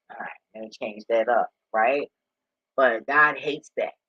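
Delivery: ordinary talk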